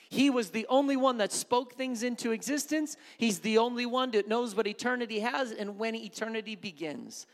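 loud, high, fervent preaching to the congregation; full-force preaching. Acted. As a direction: preach it out, pushing hard.

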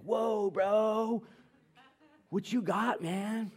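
high-pitched impressed dude voice; hammed-up awe; astonished friend. Acts out dad's astonished friend.

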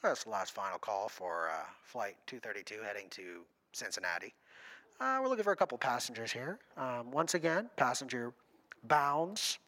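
low, flat gate announcer over the PA; deep airline gate agent paging passengers; airport announcement. Retold or imitated. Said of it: "all the rest of us are hearing".

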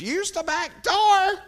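high-pitched holler, drawn out slowly; yelled voice from inside. Retold or imitated. Old woman hollering to visitors outside, loud high yell. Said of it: "we hear this voice coming out of the".

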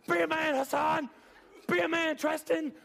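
loud, very high coach impression; high-pitched yelling coach. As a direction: holler high, like a coach in your face. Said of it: "He'd go just down the line".

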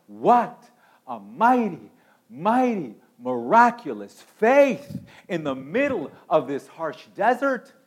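loud, rising exultant proclamation; preaching at full force. Acted. Preacher exults to the congregation over Ruth's faith. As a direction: lift the whole room.

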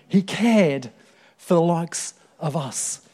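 a light Cockney lilt on "He cared for the likes of us"; a hint of a humble Cockney woman; Cockney accent. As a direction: voice the old lady lightly in Cockney. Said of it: "Cockney accent".

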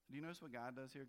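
ordinary delivery

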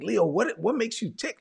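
high-pitched, wondering question, lightly acted; retold puzzled question. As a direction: ask it high, as if baffled. His curious question to Leo, retold.

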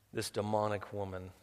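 ordinary talk

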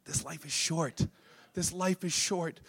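slow, very soft statement; soft, weighty preacher's refrain; hushed, grave. Real, not acted. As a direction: let it sink in quietly. Hushed refrain before final appeal.

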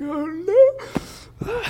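high, loud mock wail of terror. Preacher voicing disciples who think they see a ghost, high loud mock wail. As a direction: wail it like a frightened disciple at sea. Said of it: "so they just start weeping and wailing".

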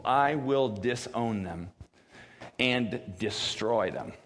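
slow, heavy delivery of "disown" and "destroy"; grave and weighty. Shown as a demonstration. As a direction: let every word land slowly. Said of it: "feel the weight of this".

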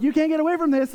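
high, loud comic pestering; hammed-up dad taunt; playful taunting dad. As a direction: call after someone hiding, teasing. Retold pestering of hiding daughter.

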